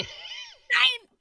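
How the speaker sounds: very high, squealed German "nein"; angry German accent. Acted. Co-host echoes the host's joke, angry German accent. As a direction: echo the mock-angry German at a high pitch. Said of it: "angry German accent".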